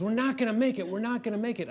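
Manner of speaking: mock panic on both repeats; panicked warning. Wife panicking boarding the cable car.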